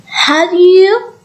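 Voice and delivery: "you" climbing high; high rising question on "Have you"; rising intonation. Shown as a demonstration. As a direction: push "Have you" steadily upward. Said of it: "the tone rises at the end".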